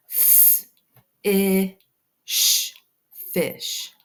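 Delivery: f, i, sh spoken as separate sounds then fish; sounding out fish. Shown as a demonstration. Teacher sounding out fish for students, slow separate sounds. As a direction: segment f, i, sh then blend fish. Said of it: "short I sound".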